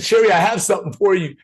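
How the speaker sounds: high, loud and quick; excited announcement. Retold or imitated. Telling Sherry on Zoom about his surprise, excited and high. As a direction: burst in with a surprise for her. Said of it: "I said".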